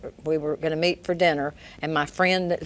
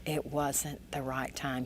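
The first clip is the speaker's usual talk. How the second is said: quiet; 11 dB quieter, slower than usual. Retold or imitated. Quoting Rick calmly.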